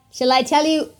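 usual speaking voice

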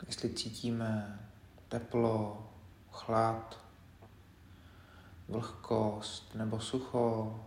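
quiet, unhurried meditation leader; guided meditation. Acted. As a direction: guide them gently through the body, leaving space between phrases. Leads a body scan meditation.

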